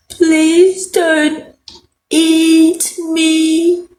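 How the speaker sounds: high pleading cry; Jack crying out. A narrator's reading. Jack begging the giant in his fist, pleading. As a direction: plead for your life. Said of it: "cried Jack".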